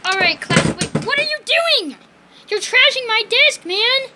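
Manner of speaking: loud, high outraged yell; shouting. Pretend. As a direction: yell at the wrecked desk. Finds desk trashed, shouts.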